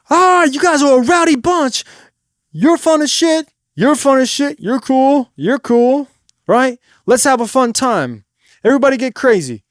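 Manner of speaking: loud, high crowd banter; stage hype. Shown as a demonstration. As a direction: work up a rowdy crowd from the stage. Demo of rowdy stage banter.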